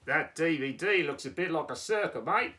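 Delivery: hammed-up Australian, rising on "mate"; Australian accent. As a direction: pipe up in broad Australian. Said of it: "you're going with the Australian accent".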